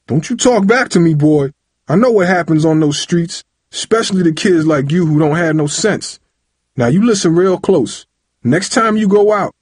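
high, loud, threatening lecture; angry tirade. A narrator's reading. Father shakes son and threatens him, angry tirade. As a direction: lay down the law furiously. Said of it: "shaking Tyree for emphasis".

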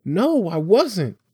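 put-on high, drawn-out boy's protest; slow it down and pitch it right up, insisting; indignant denial. Boy denying he was scared, indignant.

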